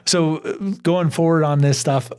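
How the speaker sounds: usual speaking voice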